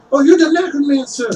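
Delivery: high, startled townsperson voice; recognizing townsperson. Acted. Townsperson spots the necromancer, high startled voice. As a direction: point him out in sudden recognition.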